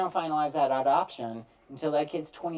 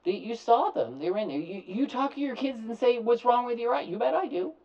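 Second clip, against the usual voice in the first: higher, animated caller voice; mock parent dialogue; 10 semitones higher, 4 dB louder than usual. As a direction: act out the caller arguing. Acted back-and-forth with parent.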